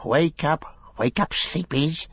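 high, eerie creature voice, wheedling; Gollum whispering. A narrator's reading. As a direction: wake them with a sly, high rasp. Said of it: "he whispered".

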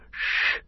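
unvoiced hissing shh; hissed shush. A narrator's reading. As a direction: hush them with a sharp hiss. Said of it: "hissed Gollum".

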